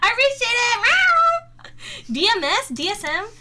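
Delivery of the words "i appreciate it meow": high, squeaky thank-you ending in a meow; playful kitten-like squeal; cutesy thanks. Pretend. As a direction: pitch it way up, cute and silly.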